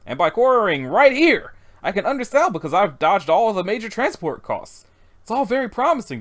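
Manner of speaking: lightly voiced, eager salesman of rock; enthusiastic businessman. Businessman bores his dinner guests about gravel, keen and enthusiastic. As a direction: lively pitch with punched key words.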